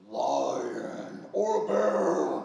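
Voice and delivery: loud, drawn-out mock roar; playful animal roar. Old man coaxing a girl to play animals, playful roar. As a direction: stretch it out big like a beast at play.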